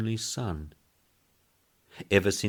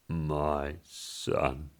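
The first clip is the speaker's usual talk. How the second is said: groaned; 3 dB quieter than usual. Acted. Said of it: "groaned the boss".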